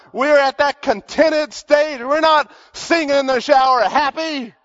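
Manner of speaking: high, loud, agitated husband's rant; worked-up suspicion. Acted. Suspicious husband fretting over his wife's singing, high and loud. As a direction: let the jealous husband's alarm run high.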